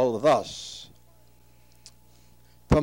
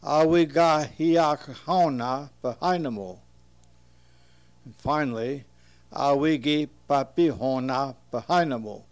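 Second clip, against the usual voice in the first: normal talking voice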